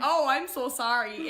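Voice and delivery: lightly put-on Minnesotan apology; Minnesotan accent. Sister imitates grandfather apologizing, Minnesotan accent. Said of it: "in his li-, little Minnesotan accent".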